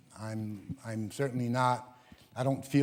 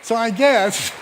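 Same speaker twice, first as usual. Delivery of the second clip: higher and louder, laughing; laughing delight; 7 semitones higher, 6 dB louder than usual. Real, not acted. Telling audience his life peaked, high and laughing. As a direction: overcome with joy, laughing it out. Joyful climax of the story.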